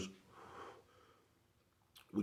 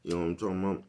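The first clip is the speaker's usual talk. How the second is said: ordinary delivery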